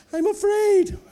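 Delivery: squeaky high frightened whimper, loud; hammed-up scared squeak; mock fear.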